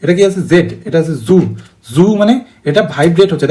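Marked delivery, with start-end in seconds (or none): 1.3-1.4 জু with a long voiced buzzing z at the start; buzzing z sound. Shown as a demonstration. Teacher demonstrating the voiced z for students to copy, buzzing. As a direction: hold the জু so the vibration is felt. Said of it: "জু বলে দেখ".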